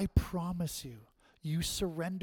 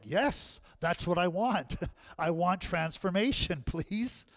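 mock eager plea; 4 semitones higher, 6 dB louder than usual. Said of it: "all of us like".

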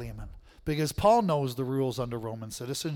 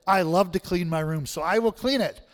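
put-on higher and louder, a cheerful eager kid; mock eager child; 3 semitones higher, 6 dB louder than usual. Preacher imitates a child eager to clean, hammed-up cheerful. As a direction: play the kid who loves chores.

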